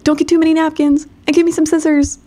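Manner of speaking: high, quick holler after someone; mock holler. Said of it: "[name] hollers at her".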